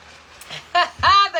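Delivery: loud burst of laughter; real laugh. Real, not acted. Reading a viewer's teasing comment, big laugh.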